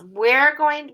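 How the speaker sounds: ordinary talk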